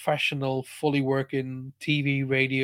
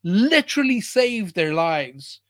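slow, louder, higher cry to the sky; raised, drawn-out angry shout; retold shout; 5 semitones higher, 4 dB louder, slower than usual. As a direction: hurl it up at the guides. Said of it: "and I shouted".

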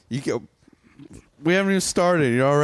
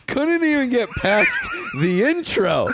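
laughing through the words; 13 semitones higher than usual. Real, not acted. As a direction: giggle as you say it. Laughing while teasing.

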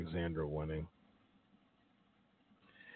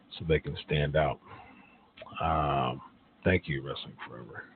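usual speaking voice

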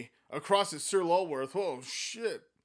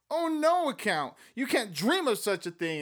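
high, louder alarmed exclamation; exclaiming; 8 semitones higher, 5 dB louder than usual. Acted. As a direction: cry out in protest. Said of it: "exclaimed Amanda".

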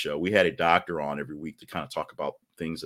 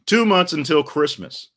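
high and loud proclamation; big countdown call; announcer proclamation; 9 semitones higher, 7 dB louder than usual. Real, not acted. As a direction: call it out like a ringmaster. Repeated countdown.

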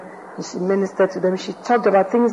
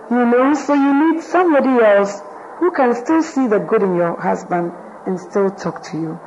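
ordinary talk, nothing marked

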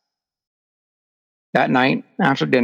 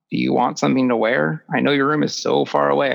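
usual speaking voice

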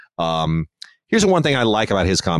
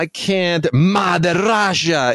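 slow, high-pitched mock Russian accent; 8 semitones higher, much slower than usual. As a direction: drag it out in a hammy Russian accent. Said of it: "Gary Oldman doing that Russian accent".

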